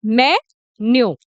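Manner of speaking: "मेन्यू" slowly split, me... nyo; correct pronunciation. Shown as a demonstration. Teacher drilling the answer for learners, very slow syllable-split "मेन्यू". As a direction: say "मेन्यू" slowly with a gap after me. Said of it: "क्या बोलेंगे".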